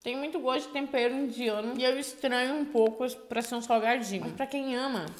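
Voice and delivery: crunchy snack bites; talking while chewing. Real, not acted. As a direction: mouth partly full. Seen 1: Bites and chews snack pieces from the bag while talking.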